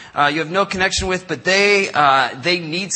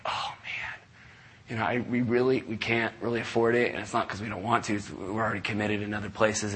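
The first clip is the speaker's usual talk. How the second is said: much softer, sheepish near-whisper; hushed apology; 6 semitones lower, 10 dB quieter than usual. Retold or imitated. Pastor quietly apologizing to a charity caller. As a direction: let them down gently and quietly.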